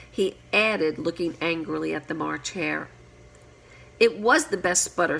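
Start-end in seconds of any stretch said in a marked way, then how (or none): none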